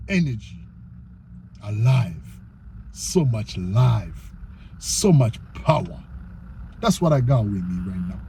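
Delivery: low, slow and soft; deep, measured savouring of each word; hushed intensity. Real, not acted. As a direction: let each phrase sink down with long pauses. Savours his energy after loud skit.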